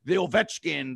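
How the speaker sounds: ordinary delivery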